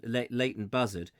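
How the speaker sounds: ordinary delivery, nothing marked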